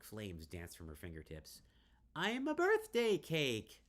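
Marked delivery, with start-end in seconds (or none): 2.2-3.8 high, loud, slow squealed delight; giddy squeal. Acted. Drunk Riley playing with flames, giddy high squeal.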